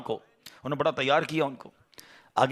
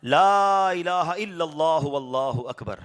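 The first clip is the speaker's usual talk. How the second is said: drawn-out takbir; much slower than usual. Acted. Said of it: "آپ کی زبان سے نکلا".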